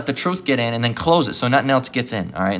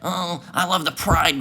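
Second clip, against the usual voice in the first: mocking voice; 5 semitones higher, 3 dB quieter, slower than usual. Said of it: "That's why you love this place".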